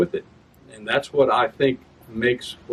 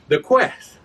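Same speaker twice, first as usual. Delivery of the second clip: "The quest." pitched higher and louder; extra syllable ending; 8 semitones higher, 5 dB louder than usual. Shown as a demonstration. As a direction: cut it short, then add a beat. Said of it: "It's called a female ending".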